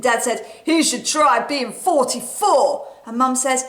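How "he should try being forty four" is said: grumpy dad. Acted. Dad grumbles.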